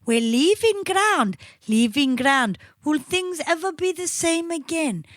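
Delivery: mock Swedish accent. Quoting lyrics to show they're very Swedish, mock Swedish accent. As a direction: recite lyrics in a put-on Swedish voice. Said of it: "But it's very Swedish".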